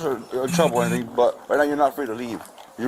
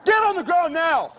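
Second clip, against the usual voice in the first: shouted surrender demands; 14 semitones higher than usual. Real, not acted. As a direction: drag out each yelled word. Officer yells commands in scuffle.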